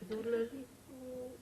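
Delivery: high yodel fading away softly; faint copy of a yodelled shout; bad echo. Mountain echoing the search party, high yodel dying away. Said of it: "It's a very bad echo".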